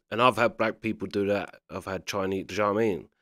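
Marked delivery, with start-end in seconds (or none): none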